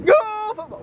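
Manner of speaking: shouted high cheer, stretched slow; booming stadium cheer. Acted. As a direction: chant it like a fan in the stands. Repeated cheer per territory.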